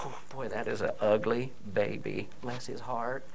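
low, soft, syrupy put-down; a hint of a Southern church lady; mock sweet church lady. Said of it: "Like, you know".

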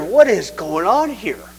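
slow, drawn-out puzzled question; confused. Retold or imitated. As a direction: act out bewilderment, stretching the words. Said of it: "That was so confusing".

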